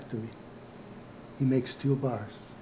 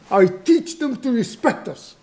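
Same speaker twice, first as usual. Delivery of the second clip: loud, high, proud outburst; raised, defiant declaration; angry self-justification; 10 semitones higher, 9 dB louder than usual. Acted. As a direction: insist forcefully on what you achieved. Grandfather justifies himself angrily.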